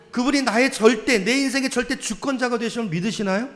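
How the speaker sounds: rising, insistent calls to the congregation; fervent revival-style questioning; preaching at full force. Acted. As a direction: press each question on the crowd.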